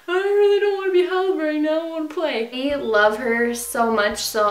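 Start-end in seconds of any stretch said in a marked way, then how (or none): 0.1-2.5 high, quick put-on puppy voice; puppy's voice. Puppy to owner who is holding her, playfully protesting. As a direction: speak for a wriggly puppy, pitched up. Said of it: "okay, she's like".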